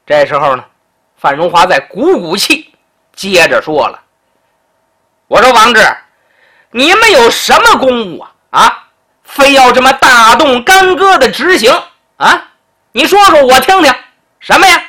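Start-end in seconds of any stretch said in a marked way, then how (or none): 5.3-14.8 loud, high lordly prodding; pompous questioning. Acted. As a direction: pile on the questions from above. Duke interrogates the secretary. (居高临下地追问)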